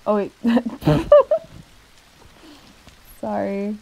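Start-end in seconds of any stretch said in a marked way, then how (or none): 0.4-1.6 sudden bright giggle at a slip; embarrassed laugh. Real, not acted. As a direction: laugh at your own mistake. Laughs at own misreading.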